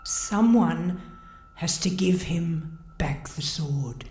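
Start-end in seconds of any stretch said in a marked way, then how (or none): 1.6-4.0 soft scared whisper. Acted. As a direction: breathe it out in fright. Whispered in fear.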